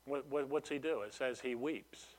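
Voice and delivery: ordinary delivery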